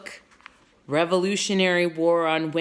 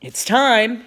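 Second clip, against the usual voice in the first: loud, raised-pitch announcement; repeated call; 5 semitones higher, 8 dB louder than usual. A narrator's reading. Captain relaying the signal to his men, loud call. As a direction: pass the call along firmly. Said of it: "he repeated".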